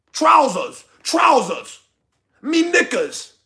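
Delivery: exaggerated British pronunciation; mock British accent. Mocks British words for pants.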